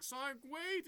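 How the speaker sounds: calling after. Retold or imitated. Tails calling after Sonic.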